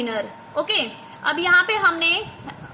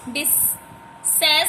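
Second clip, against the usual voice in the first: "Disaster" softer and slow in three parts; syllable split; 4 dB quieter, slower than usual. Shown as a demonstration. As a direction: break "disaster" into डि, सेस, टर with pauses. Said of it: "three हो गए ये".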